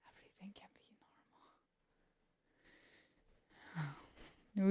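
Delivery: breathy whisper, no voice at all. Acted. Streamer repeating herself to chat in a whisper. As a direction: hush it like a secret.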